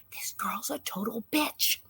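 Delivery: whispered, slow confidence; hushed to dad so nobody hears; a scandalized whispered verdict. Retold or imitated. Confiding to father.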